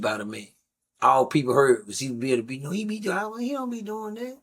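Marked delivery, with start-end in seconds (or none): 2.6-4.4 high, soft imitation of a woman covering for her husband; mock wife's denial. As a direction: voice her brushing it off, higher and softer. Neighbors voicing the wife's denial.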